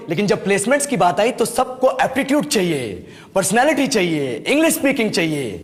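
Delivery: normal talking voice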